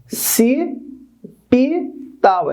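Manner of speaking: "ci, pi, tal" one syllable at a time, pauses between; separado em sílabas. Shown as a demonstration. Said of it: "Então tem que fazer assim". ("ci, pi, tal" sílaba por sílaba, com pausas)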